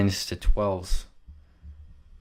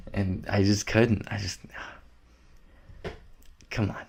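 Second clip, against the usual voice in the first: usual speaking voice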